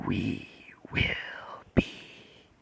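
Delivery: slow, hushed whisper with long pauses; creature voice. Acted. Creature promises Zuko power as the Bat, whispered creature voice. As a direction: whisper it as the creature inside his head. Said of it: "creature says".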